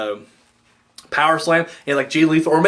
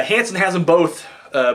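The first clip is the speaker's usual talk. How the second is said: ordinary delivery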